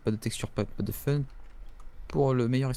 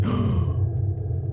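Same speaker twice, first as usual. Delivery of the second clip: gasp; 6 semitones lower, 5 dB louder than usual. Real, not acted. Startled by a scare in game.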